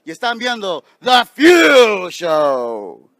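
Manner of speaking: booming ring announcer intro; big arena announcer call. Acted. Ring announcer opens the show.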